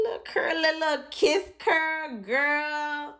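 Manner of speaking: sing-song it up high, teasing herself; high playful voice. Pretend. Vlogger teasing herself over a kiss curl, high playful voice.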